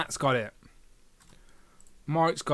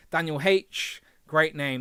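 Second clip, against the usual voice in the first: ordinary talk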